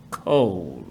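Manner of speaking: ordinary delivery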